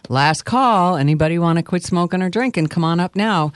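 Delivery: usual speaking voice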